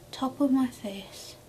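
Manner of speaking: usual speaking voice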